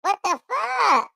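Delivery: very high, slow chipmunk voice. Real, not acted. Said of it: "it's a kid voice".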